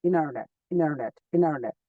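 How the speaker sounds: ইনারনেট said slowly with no t sound, in-ar-net; dropped t. Shown as a demonstration. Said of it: "এটাকে বলা যায় ইনারনেট".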